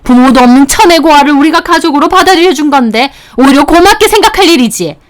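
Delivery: a mock shriek of an angry mother; mother-in-law yelling. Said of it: "장모님이 소리까지 빽 지르시더라고요". (높은 톤으로 꾸짖는 장모님, 약한 고함)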